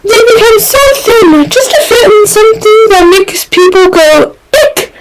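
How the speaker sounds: put-on high, quick, chatty young man; a high, gossipy Reed voice; chattering Reed. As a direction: rattle on breezily as Reed. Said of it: "he ended, making a sour face".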